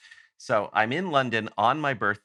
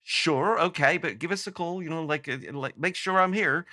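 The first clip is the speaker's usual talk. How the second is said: higher, casual voice of a rock star humouring a fan; lightly voiced polite brush-off, pitched up; imitating Andy; 7 semitones higher than usual. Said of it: "and he was like".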